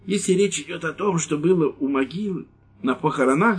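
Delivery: high, pleading whine; pleading old man. Acted. Old priest pleading with the lieutenant, high. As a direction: beg him to believe you. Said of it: "сказал старик умоляюще".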